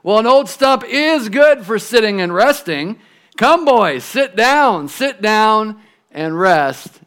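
high, loud, eager invitation; rally to offer a seat, slowing on the last words; perked-up old tree. Acted. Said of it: "straightening up as much as she could".

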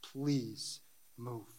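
hushed, low, slow plea; begging prayer. Acted. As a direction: beg God softly, with long pauses between words. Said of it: "I'm begging you".

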